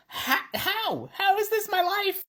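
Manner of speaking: high, squeaky amazed squeal; squealing disbelief. Real, not acted. Maker marvels to viewers at her life, squealing.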